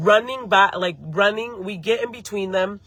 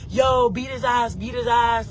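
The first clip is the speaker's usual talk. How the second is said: higher, drawn-out mock crowd chant; imitated egging on; 4 semitones higher than usual. Bystanders around the fight urging Ivan on, imitated egging on. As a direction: imitate bystanders goading someone, pitched up.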